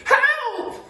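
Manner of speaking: very high, loud falsetto scream; Missus Twit screaming. Acted. Missus Twit feeling something in bed, screaming. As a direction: shriek for help. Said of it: "screamed Missus Twit".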